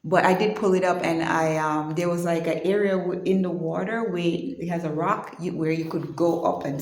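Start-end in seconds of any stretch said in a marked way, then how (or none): none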